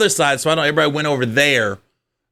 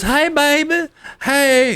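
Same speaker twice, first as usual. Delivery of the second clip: mock girlfriend coo; 13 semitones higher, slower than usual. Girlfriend voiced in story, high.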